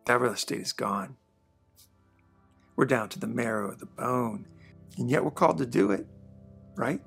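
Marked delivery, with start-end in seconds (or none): none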